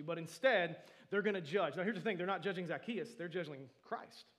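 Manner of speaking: loud, insistent preacher delivery; fervent preaching. Real, not acted. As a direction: drive the point home loudly. Preaches at full force.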